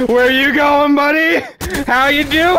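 high, loud teasing taunt; mocking taunt voice. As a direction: taunt the enemy in a silly voice.

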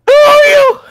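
very high, loud startled yell; alarmed shriek. Acted. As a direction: shriek at a stranger who just appeared. Fed line at sudden intruder.

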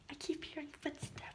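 hushed whisper so the ghost won't hear; barely breathed, scared whisper. Pretend. Listening for ghost footsteps.

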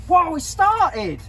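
high, drawn-out yelp of surprise; startled. Real, not acted. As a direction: cry out as it catches you off guard. Machine starts before bottle is placed.

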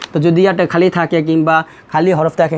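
normal talking voice

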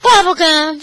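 put-on high, loud child's retort; exasperated answer. A narrator's reading. Tanya finally choosing after heaving a sigh, exasperated. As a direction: blurt the flavour loudly and high.

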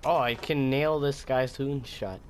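ordinary talk

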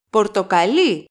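Πορτοκαλί stressed on the final syllable, λί; stress on last syllable. Shown as a demonstration. Teacher contrasts the color with the fruit, Πορτοκαλί stressed on final λί. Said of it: "The last syllable of the word".

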